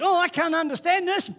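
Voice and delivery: put-on high, loud, bewildered quote of his young self; bewildered younger self. Preacher retelling his bewildered young self, high and loud.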